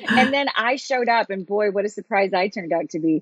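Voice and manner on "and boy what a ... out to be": usual speaking voice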